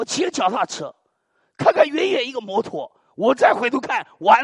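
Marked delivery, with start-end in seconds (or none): none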